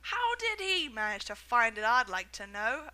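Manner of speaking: loud resentful shouting; angry shout. A narrator's reading. Large boy shouting angrily at the lucky finder. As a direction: demand it bitterly. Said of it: "a large boy shouted angrily".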